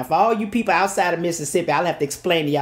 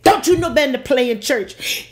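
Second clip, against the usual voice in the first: high, loud scolding father; daddy scolding; 7 semitones higher, 4 dB louder than usual. Retold or imitated. Angry father scolding his daughter for playing in church, high and loud.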